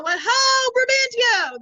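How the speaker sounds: loud, high theatrical hail; calling out. Acted. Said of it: "so then they call out".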